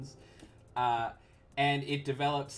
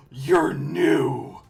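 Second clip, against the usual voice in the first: huge booming exclamation; booming loud voice; 2 semitones higher, 13 dB louder than usual. Acted. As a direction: boom it out like a whole building talking. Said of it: "booms with a loud voice".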